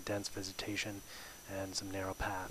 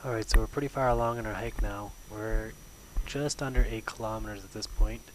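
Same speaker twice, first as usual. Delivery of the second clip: raised voice outdoors; loud over wind; 8 dB louder than usual. Real, not acted. Loud windy narration on trail.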